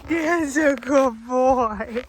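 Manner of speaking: slow, loud, squeaky cooing praise; squeaky praising a dog. Real, not acted. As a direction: gush over the dog.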